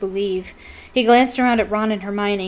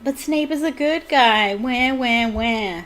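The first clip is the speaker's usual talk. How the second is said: mocking whine; 4 semitones higher, 3 dB louder, slower than usual. Reader mocking Snape's defenders.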